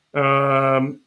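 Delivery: talking through a burp; a burp catching the word. Real, not acted. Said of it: "I'm burping, sorry".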